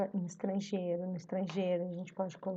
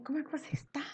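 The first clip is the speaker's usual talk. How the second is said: exclamação; 6 semitones higher, 4 dB quieter, much faster than usual. Shown as a demonstration. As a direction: exclaim it lightly, pitched up. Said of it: "tipo, exclamando". (exclamando em tom agudo)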